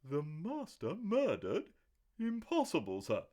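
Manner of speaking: shocked gasp. A narrator's reading. Butler Parker stunned to hear his master is murdered. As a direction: gasp it out in disbelief. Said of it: "A gasp broke from Parker".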